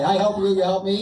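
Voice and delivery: ordinary delivery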